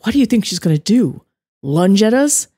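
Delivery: sharp, angry questions. A narrator's reading. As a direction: challenge the guard with anger. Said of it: "anger tinting his words".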